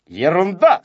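loud, confident dismissal. A narrator's reading. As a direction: brush the worry aside firmly. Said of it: "сказал Незнайка уверенно".

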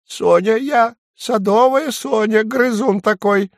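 a cheeky little dormouse pleading its case; tiny dormouse squeaking at the woodpecker; high dormouse voice. Acted. Dormouse character voice.

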